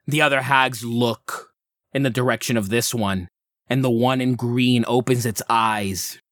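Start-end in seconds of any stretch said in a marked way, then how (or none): none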